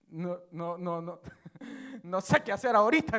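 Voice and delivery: low, soft flustered woman, laughing; a lightly voiced woman caught out, giggling.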